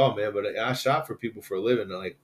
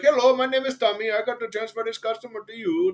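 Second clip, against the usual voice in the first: very high-pitched imitation of a phone agent; mock call-center agent; 9 semitones higher than usual. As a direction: put on a high customer-service voice. Imitates overseas support agent transferring call.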